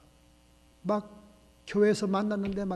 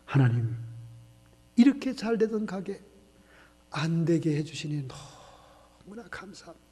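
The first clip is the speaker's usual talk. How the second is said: hushed prayer; 2 semitones lower, 4 dB quieter, slower than usual. Acted. Pastor prays in the shop.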